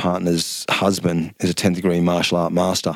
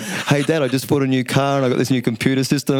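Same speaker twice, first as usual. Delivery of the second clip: higher, chirpy son voice; cheerful son; 7 semitones higher than usual. Retold or imitated. His son phoning about a new car, chirpy. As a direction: bounce in with good news. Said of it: "my kid's like".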